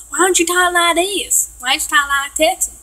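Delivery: exaggerated Texan twang, slowed down; mock Texan accent. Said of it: "I don't talk like that".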